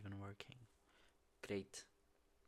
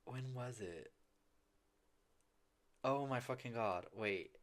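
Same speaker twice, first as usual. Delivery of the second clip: usual speaking voice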